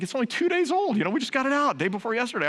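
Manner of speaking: high, fast mock-wife voice; mimicking wife. Husband mocks wife's protest over the wash rag, high and fast. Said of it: "[name]'s like".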